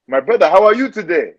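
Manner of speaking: hearty put-on African accent. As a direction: greet a brother in an African accent. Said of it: "my accent".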